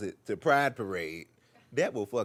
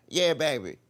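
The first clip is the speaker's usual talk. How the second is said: aunt on phone; 4 semitones higher, 9 dB louder than usual. Retold or imitated. Aunt Jackie answers phone.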